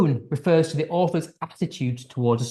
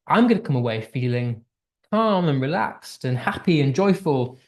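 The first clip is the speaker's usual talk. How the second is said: normal talking voice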